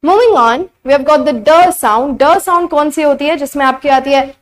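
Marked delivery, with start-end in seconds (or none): none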